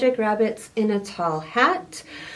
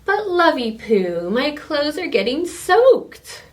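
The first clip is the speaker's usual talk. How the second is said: high, louder whine; whiny complaint; 6 semitones higher, 4 dB louder than usual. Acted. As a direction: whine about the wet clothes. Wizard complains, soaked.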